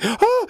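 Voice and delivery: sharp high gasp; the boy startled to find himself chained; frightened gasp. Acted. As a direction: gasp like a scared child waking.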